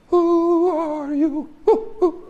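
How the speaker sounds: high, slow, drawn-out sneer; put-on incredulous crowd voice; mocking question.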